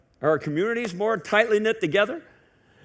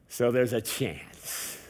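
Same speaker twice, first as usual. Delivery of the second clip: mock hopeful; 6 semitones lower, 6 dB quieter, much slower than usual. Mocking politicians' hopeful line.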